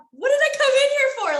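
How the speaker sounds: high, loud, fast mock bewilderment; confused. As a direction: play yourself lost in the kitchen, baffled. Acting herself lost in kitchen.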